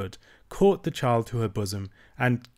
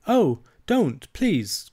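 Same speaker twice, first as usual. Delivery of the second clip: higher and louder, a sudden plea; frightened cry; 7 semitones higher, 4 dB louder, much slower than usual. A narrator's reading. Irene begs grandmother not to throw the rose, loud frightened plea. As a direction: burst out in alarm, pleading. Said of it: "cried Irene".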